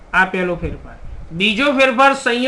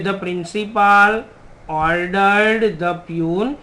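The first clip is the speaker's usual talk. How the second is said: very slow, word by word dictation; 3 semitones lower, 3 dB quieter, much slower than usual. Shown as a demonstration. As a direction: spell the sentence out slowly for the class. Teacher dictates the answer sentence. (ધીમે ધીમે લખાવવું)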